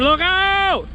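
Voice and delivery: high yelled warning to the crew; retold warning yell. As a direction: shout the warning as it happened. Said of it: "I yelled, I said".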